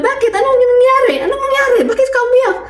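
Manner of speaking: high, alarmed teacher's questions; worried teacher. Retold or imitated. Teacher asking why the boy is crying, high and alarmed. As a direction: ask what happened, pitch way up. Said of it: "sabi ng teacher namin".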